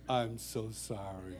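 hammed-up remorse, dragged out quietly; mortified apology. Kid apologises to the dinner guest, slowly mortified.

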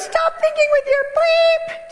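very high, slow mocking scold; scolding mother-in-law. Dutch mother scolding her son, high mocking voice. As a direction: sneer at your son. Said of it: "And she goes".

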